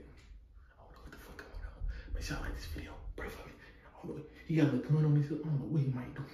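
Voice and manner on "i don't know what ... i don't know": hushed, secretive whisper. Real, not acted. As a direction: whisper so he can't hear. Hiding from friend, talking to camera.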